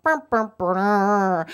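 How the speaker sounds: slow, descending sad-trombone imitation; losing horn noise. Contestant is out after two guesses, mock losing horn. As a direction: imitate the game show's failure horn.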